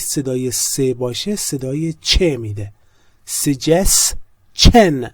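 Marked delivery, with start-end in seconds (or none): 3.4-5.1 Suggestion drawn out very slowly, ending in a clear chen sound; careful pronunciation. Shown as a demonstration. English teacher modelling the right sound for students, slow and careful. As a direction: spell out Suggestion slowly with ch, not sh, in the last syllable. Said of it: "not shen".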